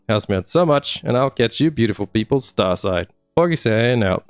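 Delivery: usual speaking voice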